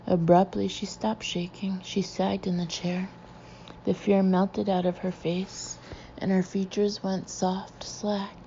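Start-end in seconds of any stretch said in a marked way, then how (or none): none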